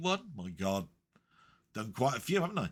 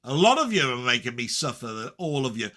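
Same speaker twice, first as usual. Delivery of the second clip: ordinary delivery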